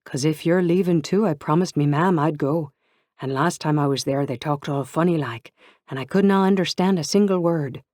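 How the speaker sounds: a friend mimicking her Irish relatives; mimicked Irish accent. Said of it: "she said in a strong Irish accent".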